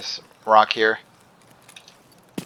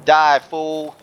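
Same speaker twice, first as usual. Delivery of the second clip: trash talk; 7 semitones higher, 4 dB louder than usual. Real, not acted. Shooting the enemy who startled him.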